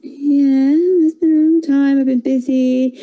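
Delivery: put-on breezy young girl; little girl voice. A narrator's reading.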